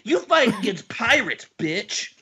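high-pitched comic taunt; mock defiant challenge. Podcaster spoofing the pirate's challenge, mock defiance.

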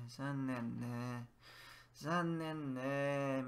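Zannen ne drawn out slowly; slow sympathetic zannen ne. Shown as a demonstration. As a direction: stretch zannen, let ne hang. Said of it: "It's like saying, "Isn't it?".

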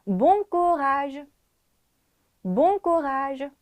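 Bon courage slow and clear, held out; model pronunciation. Shown as a demonstration. Teacher repeats the phrase for students to follow, slow model pronunciation. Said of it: "다시 해볼게요".

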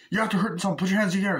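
high-pitched mock cop command; urgent order. Retelling cop to the driver, urgent order. Acting armed standoff.

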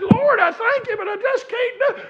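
very high, loud cry of praise; full-force revival holler; ecstatic church shouting. Retold or imitated. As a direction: imitate a worshipper overcome, shouting thanks to heaven. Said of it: "she shouted".

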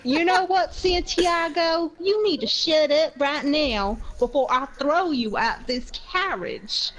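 stretched-out words of an affronted customer; indignant threat. Acted. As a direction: lay down a deliberate mock threat.

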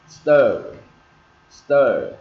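loud, drawn-out "er" in "stir", falling; long falling "stir" with a strong R; R-coloured vowel. Shown as a demonstration. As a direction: hold the curled-tongue R at the end of "stir". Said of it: "Nghe nó hơi ờ một tí".

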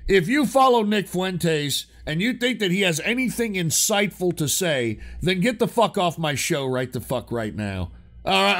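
normal talking voice

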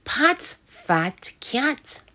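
slow teacher read-along; slow read-aloud. Shown as a demonstration. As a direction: read slowly for kids to follow. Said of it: "Read that with me".

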